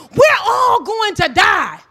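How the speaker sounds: normal talking voice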